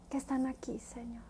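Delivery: soft unvoiced whisper; whispered prayer. Real, not acted. Preacher praying for the listeners in a whisper. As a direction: let the plea fall to a whisper.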